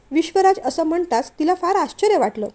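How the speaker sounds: plain reading voice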